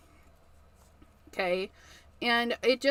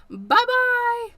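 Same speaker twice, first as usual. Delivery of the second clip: cheerful bye; 11 semitones higher, 7 dB louder than usual. Real, not acted. Cheerful sign-off to viewers.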